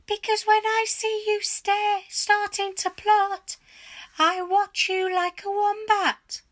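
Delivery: high, loud nagging hag voice; shrill old wife. A narrator's reading. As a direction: snap at him like a suspicious old hag. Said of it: "Missus Twit said".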